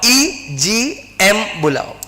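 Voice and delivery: very slow, stretching EGM बुलाओ out word by word; slow drilled answer. Shown as a demonstration. Teacher drilling students on the answer, very slow. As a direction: spell out EGM बुलाओ syllable by syllable for the class. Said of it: "क्या बुलाओ?".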